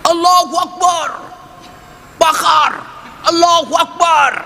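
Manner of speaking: mock mob chant, high and hammered; rioters' shout. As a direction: yell it like a mob setting fires. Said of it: "Teriak dia".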